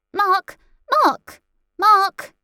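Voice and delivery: loud high calls of a name; shouting. Acted. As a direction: yell the name to wake him. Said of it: "shouted Celine".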